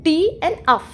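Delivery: "टी and अफ" as two separate parts of tough; split into sounds. Shown as a demonstration. Teacher breaking tough into two phonemes, "टी and अफ" said separately. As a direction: say "टी", then "अफ", each set apart. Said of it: "सिर्फ दो चीज़ों की आती है".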